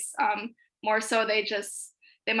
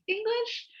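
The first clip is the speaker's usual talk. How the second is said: high, bright questioning pitch, imitating a waiter; a much higher, lightly mocked French server asking; helpful French server; 13 semitones higher than usual. French waiter offers English to American students, high mock voice. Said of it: "be like".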